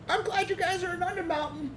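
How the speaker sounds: high, sinister crone voice; evil old lady voice. Acted. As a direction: purr it like a wicked old witch. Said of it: "she's incredibly evil".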